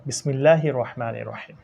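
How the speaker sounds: a gentle recited bismillah; recitation. Acted.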